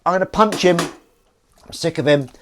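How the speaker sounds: loud, high-pitched exasperated outburst; worked-up rant. Retold or imitated. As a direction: play up being fed up, loudly. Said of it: "I was like".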